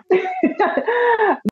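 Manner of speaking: high giggle, caught in awe; starstruck giggle. Real, not acted. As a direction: giggle like a nervous fan. Starstruck guest meeting host.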